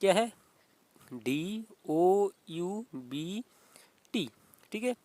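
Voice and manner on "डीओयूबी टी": letters spelled out one by one, very slowly; dictating the spelling slowly to students. Shown as a demonstration. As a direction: spell the word out for the class letter by letter. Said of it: "B का संबंध यानी कि".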